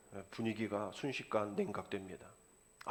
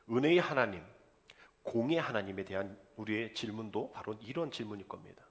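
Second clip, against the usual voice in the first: normal talking voice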